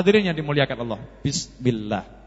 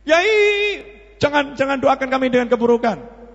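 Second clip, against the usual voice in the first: pleading cry; 9 semitones higher, 3 dB louder than usual. Acted. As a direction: call out to the kiai, begging in mock fear. Villagers begging the kiai in fear. (memohon dengan panik)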